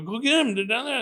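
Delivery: high, loud, insistent plea; urgent urging. Retold or imitated. Shot man urging cops after the shooter, high and urgent. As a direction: push the cops to move, pitch way up.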